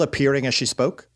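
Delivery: usual speaking voice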